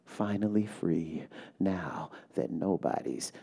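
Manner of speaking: slow, soft, measured poem reading; poem recitation. Acted. Poet reading her poem to a hushed audience, slow and soft. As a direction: let each line settle, quiet and deliberate.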